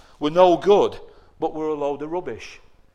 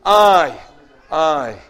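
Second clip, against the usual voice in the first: self-centred whine; 9 dB louder, much slower than usual. Acted. Mocking selfish "I" chant.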